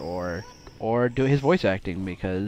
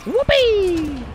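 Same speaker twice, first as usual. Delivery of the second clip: high gleeful whoop; 7 dB louder than usual. Real, not acted. Gleeful yelp while playing.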